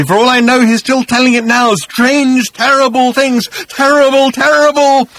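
savage cry building to a scream; frantic, very high, loud raving. A narrator's reading. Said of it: "he screamed".

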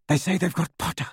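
fast, clipped icy lady; a chilly aside to her husband; cold voice. A narrator's reading. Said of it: "said Narcissa's cold voice".

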